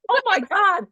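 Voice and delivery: high, loud burst of real shock; shocked gasp. Real, not acted. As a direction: let the news hit you. Moved reaction to the obituary story.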